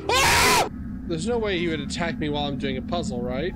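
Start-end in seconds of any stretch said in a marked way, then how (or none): 0.1-0.7 very high yelp, then fast loud pleading; startled shout. Real, not acted. Gamer shrieking as a creature appears mid-puzzle. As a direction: scream and babble in fright.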